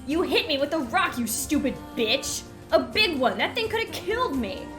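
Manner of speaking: high, loud outraged shout; the bully yelling at the old woman; furious yelling. Acted. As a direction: spit the accusations in pain. Bully screaming accusations.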